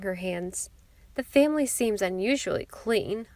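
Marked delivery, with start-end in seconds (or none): none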